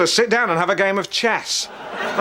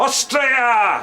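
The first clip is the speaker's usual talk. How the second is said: exasperated shouted correction. Acted. Correcting a friend who got the film wrong, exasperated shout.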